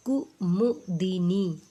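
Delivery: slow कुमुदिनी, split "ku-mu-di-nee"; each syllable of कुमुदिनी on its own; syllable-by-syllable demo. Shown as a demonstration. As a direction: dictate कुमुदिनी slowly by syllable. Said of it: "ठीक है".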